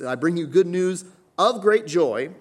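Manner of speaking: high, loud announcement; proclaiming scripture. Acted. Pastor reads the angel's words, loud and high. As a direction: ring it out like a herald.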